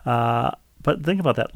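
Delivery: ordinary delivery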